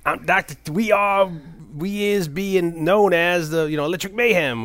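lightly voiced Muppet bandleader, high; Doctor Teeth voice. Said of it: "which is, uh, Doctor Teeth".

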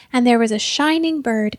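ordinary delivery, nothing marked

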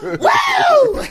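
high, loud laughing whoop; party whoop. Pretend. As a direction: cheer it like a party animal. Cheering about getting wasted.

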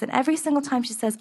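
ordinary talk, nothing marked

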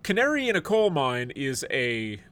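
ordinary talk, nothing marked

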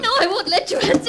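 fast, high, frantic plea; desperate to stop him; panicked refusal. Acted. Wife blocking husband from the phone, panicked refusal.

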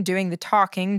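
ordinary delivery

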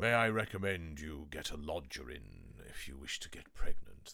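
a hint of a proper old-fashioned GP giving advice; mock-solemn doctor's manner, understated; prim old TV doctor. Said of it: "That sort of thing".

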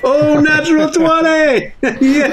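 high, drawn-out cheer; real celebration. Real, not acted. Player celebrates a natural twenty roll, elated cheer. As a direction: crow with laughing delight.

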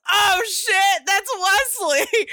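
she suddenly realizes it's him, yelled up high; shocked realization. Retold or imitated. Buttercup realizing her mistake.

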